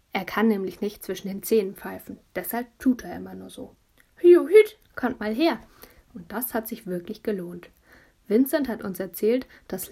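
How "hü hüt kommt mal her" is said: high loud "Hü hüt" then calling over; mock whistle, then wave them in; fake whistle call. Boy calling the neighbour kids to the balcony, mock whistle.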